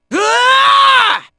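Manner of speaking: long, high attack yell; battle yell. Acted. Fighter launches an attack, long high yell. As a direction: let out a sustained fighting yell.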